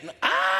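sudden high yelp of fright; startled yelp. Retold or imitated. Pastor reliving the mouse moment, a sudden yelp. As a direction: jump at the mouse with a cry.

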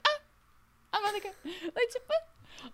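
high, soft, airy little sigh of a line; demure. Retold or imitated. Mocking Anna's demure exit, high and soft. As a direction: play her as dainty and demure. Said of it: "like, demure".

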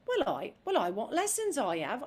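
put-on chirpy Cockney flower girl asking for lessons; Cockney Eliza. Shown as a demonstration. Actress demoing Eliza's open Cockney manner, bright. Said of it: "She goes".

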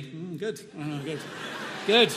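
short, understated approving murmurs; mock approval. Wife hearing her husband call himself a lecturer, mock approving. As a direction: imitate a satisfied, approving listener. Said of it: "looked at me approvingly".

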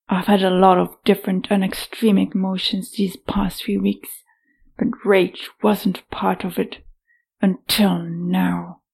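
seething, whispered through gritted teeth. Acted. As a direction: hiss the rage through clenched teeth. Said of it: "speaking through her teeth".

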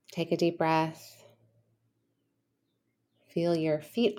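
soft, calm guided meditation voice. Acted. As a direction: gently settle the listener, leaving long pauses.

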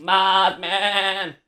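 high bleating cry of the name; goat-like Mothman call. Pretend. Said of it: "Why does he sound like a goat?".

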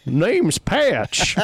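a mock Canadian take on "Name's Patch"; Canadian accent. Shown as a demonstration. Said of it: "maybe that's Patch's accent".

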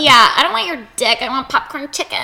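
high, loud joking partner voice; mock bored partner. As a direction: whine about popcorn chicken. Mock partner refusing sex.

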